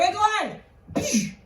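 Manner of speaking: high, drawn-out challenge; calling out. Acted. David taking aim with his slingshot, high taunting call. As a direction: holler up at a giant.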